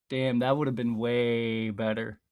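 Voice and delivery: ordinary talk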